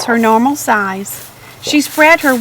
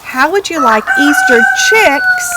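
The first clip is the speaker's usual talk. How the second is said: a high, eager sales pitch; eager cry; 10 semitones higher than usual. Acted. Farmer offering chicks to the egg lady, high eager cry. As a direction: pitch it up like a bargain. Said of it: "cried Missus Pennyworth".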